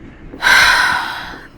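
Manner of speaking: long weary exhale through the nose; heavy sigh. Acted. Officer sighing at a trek that is too easy. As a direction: sigh with open disappointment.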